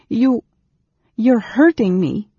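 high, loud protest; distressed girl. A narrator's reading. Girl struggles in the mage's grasp, distressed.

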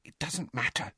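soft, hushed reassurance; whispered. A narrator's reading. Hermione calming her angry friends, whispered. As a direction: say it very softly, under the breath. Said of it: "Hermione whispered".

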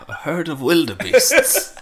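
drawn-out documentary impression through laughter; David Attenborough. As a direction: play the wildlife narrator for laughs. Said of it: "David Attenborough plays Simba".

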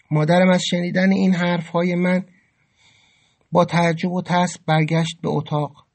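plain reading voice

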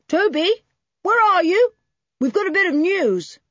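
high, loud call across the farmyard. A narrator's reading. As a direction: call out to him, far off. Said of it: "called Toby".

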